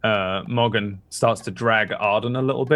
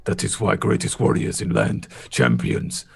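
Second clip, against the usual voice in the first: gruff guard voice in broken English; a simple-spoken bodyguard talking plainly; broken-English warrior. Acted. Tolgar continues.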